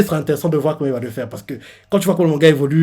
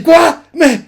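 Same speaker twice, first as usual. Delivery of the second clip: high, loud shocked shout; yelled in sudden shock; shocked cry; 13 semitones higher, 7 dB louder, slower than usual. Pretend. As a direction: cry out in disbelief at the sight of him. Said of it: "elle va être choquée".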